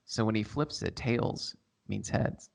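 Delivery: slow breathy ASMR whisper. Pretend. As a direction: whisper it into the mic. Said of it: "Minute starting A S M R now".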